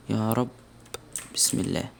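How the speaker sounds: whispered, nervous bismillah; whispered prayer. Real, not acted. Gamer whispering before a scary room, nervous whisper. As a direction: breathe it out under your breath.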